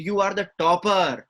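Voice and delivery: loud, very slow, every word pressed, "topper" falling; waking-up emphasis. Shown as a demonstration. Teacher rousing a student with slow, loud emphasis. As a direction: say it to shake the listener awake. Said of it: "मैं आपको जगा रहा हूँ".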